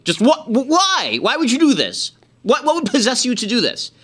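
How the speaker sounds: high, pleading disbelief; incredulous. Real, not acted. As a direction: ask it as if you cannot believe it. Incredulous ranting questions at Trump.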